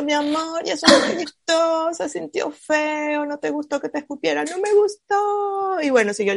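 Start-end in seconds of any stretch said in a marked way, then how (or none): 0.0-4.4 tender comfort. Retold or imitated. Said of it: "Entonces yo". 4.4-5.8 much higher, slow whimper; child answering through tears; tearful child. Retold or imitated.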